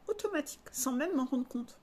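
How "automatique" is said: Automatique said high with a falling end; stressed automatique. Shown as a demonstration. Vlogger repeating Automatique for emphasis, high and falling.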